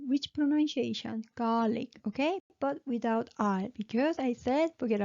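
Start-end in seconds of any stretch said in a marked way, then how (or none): none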